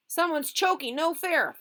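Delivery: high, loud cry in a fight; outcry. Acted. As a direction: cry foul mid-brawl.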